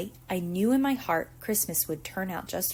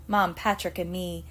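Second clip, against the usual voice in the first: plain reading voice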